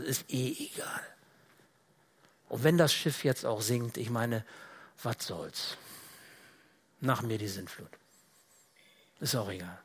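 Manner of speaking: leise, tiefer, müde abwinkend; resigniert. Acted. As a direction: give up, low and quiet, with pauses. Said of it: "vielleicht auch so resigniert". (resigniert und gleichgültig, leise)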